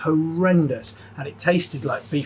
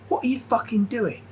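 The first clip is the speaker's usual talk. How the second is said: higher, incredulous chef voice; mocking chef; 8 semitones higher than usual. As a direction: mimic the boss's disbelief. Imitating head chef mocking him.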